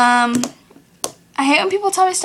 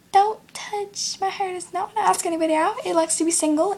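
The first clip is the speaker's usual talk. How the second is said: sassy comeback; 5 semitones higher than usual. Retold or imitated. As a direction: talk down to her like a diva.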